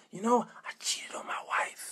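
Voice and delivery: hushed, breath-only admission; whispered confession. Acted. Man confessing to the pastor in his office, whispering. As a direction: whisper it like a guilty secret.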